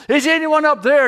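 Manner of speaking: loud, high shout upward; yelled call for help. Retold or imitated. Fallen hiker calls up a second time, yelling. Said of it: "he yelled".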